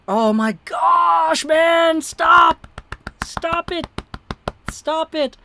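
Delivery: high, loud frustrated yells; exasperated shouting. Real, not acted. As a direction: yell at the hiccups to stop. Yelling at own hiccups.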